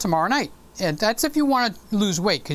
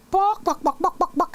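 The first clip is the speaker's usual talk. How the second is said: high chicken buck-buck, much higher; teasing the silent viewers with chicken clucks; chicken clucking; 11 semitones higher than usual. Acted. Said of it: "What are you guys, chicken?".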